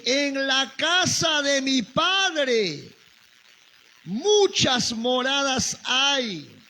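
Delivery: preacher reading the Gospel aloud. Acted. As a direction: read it out like a sermon. Reads Jesus's words from John 14.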